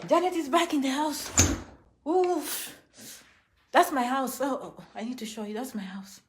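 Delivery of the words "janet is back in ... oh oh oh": ordinary talk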